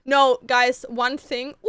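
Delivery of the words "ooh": drawn-out, wavering "ooh"; sustained high hoot that wobbles along; long wailing ooh. Real, not acted. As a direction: let out a long theatrical wail. Dreading a favourite character's miscasting.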